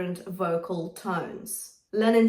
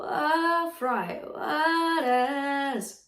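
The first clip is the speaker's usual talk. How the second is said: fry demo; 6 semitones higher, much slower than usual. Shown as a demonstration. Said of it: "there's a little".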